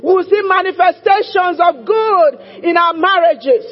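loud fervent declaring prayer; preaching prayer. Acted. Preaching prayer at full force.

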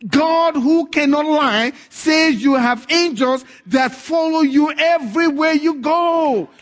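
loud, high preaching voice, building; fiery preaching. Acted. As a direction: preach it to the congregation. Preacher at full force.

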